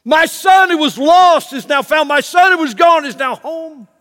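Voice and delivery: loud, high rejoicing cry, slowed on the lost and found; hollering praise at full voice; joyful shout. Acted. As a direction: shout it out like a father who got his son back. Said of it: "and he screams".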